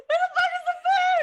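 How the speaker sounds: laughing, frustrated yell across the room; exasperated yell. Real, not acted. Streamer yelling about the late food delivery, loud and laughing. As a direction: shout for the missing food, half laughing. Real chaos, hungry, food missing.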